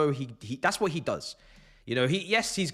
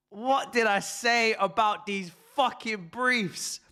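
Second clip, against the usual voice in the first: usual speaking voice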